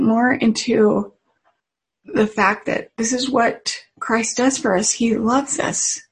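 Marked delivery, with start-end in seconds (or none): none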